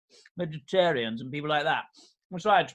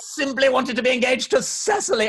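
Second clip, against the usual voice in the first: slow, loud, drawn-out cry of adoration; mock declaration; 5 semitones higher, 4 dB louder, slower than usual. Algy loudly echoes Jack's lovesick cry, hammed up. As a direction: ham it up, matching his friend's passion.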